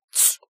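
short tongue click of annoyance; dismissive tsk; annoyed tsk. A narrator's reading. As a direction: tut sharply under your breath. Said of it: "цыкнул Кен".

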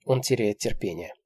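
ordinary delivery, nothing marked

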